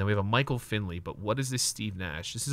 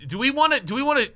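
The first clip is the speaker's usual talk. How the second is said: much higher, louder tumbling question; excited squeal; 7 dB louder, faster than usual. Real, not acted. Collector thrilled at a card pull, squealing. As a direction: voice jumps up with giddy excitement.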